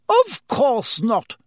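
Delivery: offended nurse snaps back; bristling. Acted.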